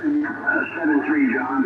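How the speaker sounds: ordinary talk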